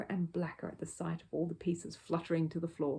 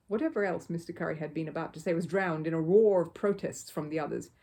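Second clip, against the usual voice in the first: plain reading voice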